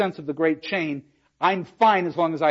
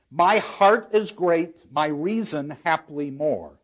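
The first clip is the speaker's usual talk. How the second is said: slow dramatic reading; slower than usual. Shown as a demonstration. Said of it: "I love it".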